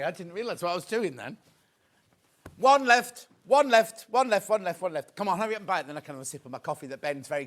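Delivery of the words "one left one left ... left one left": loud urgent call fading down to normal; rally the viewers as stock runs out; excited countdown. Real, not acted. Presenter to viewers, last one left, excited loud call fading.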